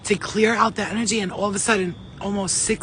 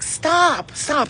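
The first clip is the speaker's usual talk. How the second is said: high, loud cry of stop; dream shout; 7 semitones higher, 4 dB louder than usual. Retold or imitated. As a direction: yell it as if fending off a snake. Retold dream, fighting off a snake.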